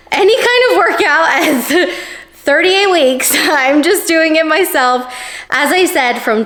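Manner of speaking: high, laughing voice. Real, not acted. Laughing at her own caveat.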